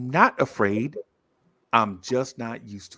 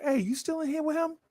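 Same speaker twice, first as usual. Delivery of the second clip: boss checking in; 14 semitones higher, 5 dB quieter than usual. Retold or imitated. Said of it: "My boss like".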